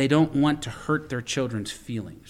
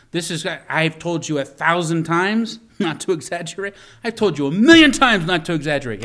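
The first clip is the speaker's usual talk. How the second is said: building from raised to a full shout; exasperated parent yelling; 5 semitones higher, 7 dB louder than usual. Retold or imitated. Pastor mimicking a fed-up parent scolding, rising shout. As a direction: scold the kid, climbing to a yell.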